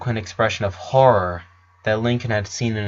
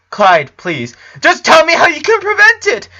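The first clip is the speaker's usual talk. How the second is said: much higher and louder, begging; desperate plea; 7 dB louder than usual. A narrator's reading. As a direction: demand an answer in desperation. Lincoln begs desperately.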